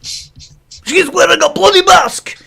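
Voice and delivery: high, slow, outraged echo; mock outrage. Host echoing his cohost about the mask, slow and outraged. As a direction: drag out bloody mask in disgust.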